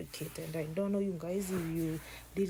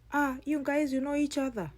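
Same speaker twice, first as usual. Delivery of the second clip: surprised question; 6 semitones higher, 4 dB louder than usual. Acted. Guy surprised the sisters know each other.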